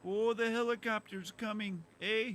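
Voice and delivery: put-on high Minnesota accent with a tacked-on eh; mock Minnesota accent. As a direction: ham up the Minnesota lilt. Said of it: "doing five accents at once".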